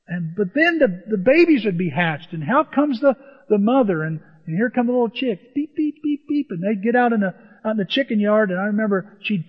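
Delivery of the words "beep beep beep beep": high, short chick peeps; chick peeping. Retold or imitated. Preacher shows the little chicks coming out, high imitated peeping. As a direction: imitate baby chicks going beep.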